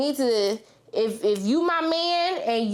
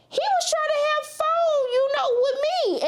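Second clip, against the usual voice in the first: mock scandalized. Retelling church boy's advances.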